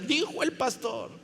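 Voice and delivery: a toned-down mock of someone citing authority; mocking echo. Preacher mimicking those who blame him, mocking.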